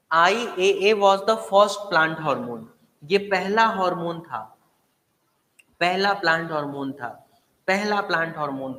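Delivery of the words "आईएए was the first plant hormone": very slow, louder, each word hammered; emphatic and slow. Shown as a demonstration. Teacher stressing the most asked exam fact, very slow and loud. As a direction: hammer every word home. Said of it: "कई बार ये exam में पूछ चुका है".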